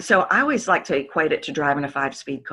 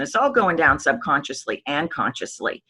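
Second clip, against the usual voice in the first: ordinary talk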